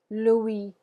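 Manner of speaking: "Louis" said as loo-EE, no final S; French "Louis", ending on "ee" with a silent S; French pronunciation. Shown as a demonstration. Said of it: "repeat after me".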